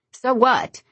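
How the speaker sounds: high, loud retort; challenging. A narrator's reading. Lauren snapping back at Beverly, high and loud. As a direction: throw it back at her.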